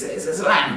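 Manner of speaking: "lamb" said high with the B dropped; Italian-style lamb. Shown as a demonstration. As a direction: slip into his dad's "lamb". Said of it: "I caught myself doing this".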